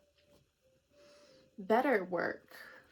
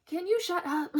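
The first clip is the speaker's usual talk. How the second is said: exasperated; 8 semitones higher than usual. Real, not acted. Exasperated at the hater.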